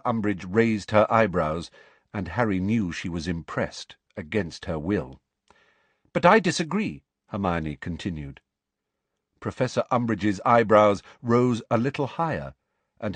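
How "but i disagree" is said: high, firm schoolgirl voice; clear, carrying voice. A narrator's reading. Pupil challenges the textbook before the teacher, clear carrying voice. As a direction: speak up in disagreement. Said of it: "speaking in a clear, carrying voice".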